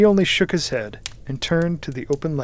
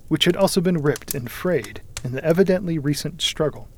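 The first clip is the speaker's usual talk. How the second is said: plain reading voice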